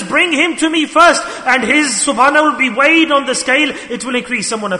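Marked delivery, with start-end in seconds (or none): 0.0-1.2 proclaiming command. Acted. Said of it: "Allah says".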